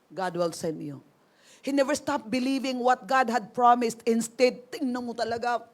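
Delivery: usual speaking voice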